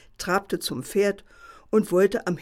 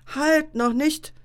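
much higher, slow warning; fox objecting; 8 semitones higher, 4 dB louder, slower than usual. A narrator's reading. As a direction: hold the wolf back, slowly and sharply. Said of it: "entgegnete der Fuchs".

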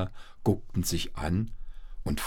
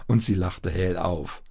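usual speaking voice

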